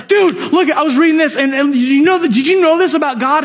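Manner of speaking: high, eager and quick; gushing with a new discovery; excited. Retold or imitated. Excitedly sharing discovery with colleague.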